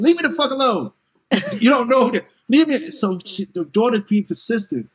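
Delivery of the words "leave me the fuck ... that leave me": higher, louder brush-off; mock annoyed mother. Mother snapping at her daughter, loud and higher. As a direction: shoo the kid away, irritated. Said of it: "the mother saying".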